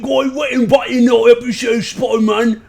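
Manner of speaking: loud, high, hammed-up British accent; over-the-top British lad, shouting high; mock British accent. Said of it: "been working on, um, I'm learning the, the King's English".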